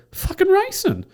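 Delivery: very high-pitched indignant comeback; mock indignant squawk; indignant retort. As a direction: answer back as if it's obvious, voice shooting up. Acted indignant reply to friend.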